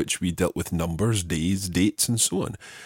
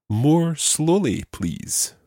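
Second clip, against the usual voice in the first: slow prompt; 7 semitones higher, much slower than usual. Shown as a demonstration. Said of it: "let's try another phrase".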